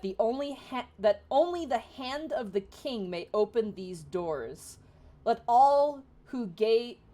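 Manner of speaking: slow, loud, grand proclamation; solemn inscription reading. Acted. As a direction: read it like ancient carved words. GM reads the door inscription.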